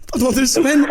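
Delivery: can't hold the joke together; laughing through words. Real, not acted. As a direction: prank caller cracking up while explaining braille classes, laughing. Prankster cracking up mid-line.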